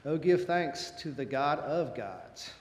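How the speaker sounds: normal talking voice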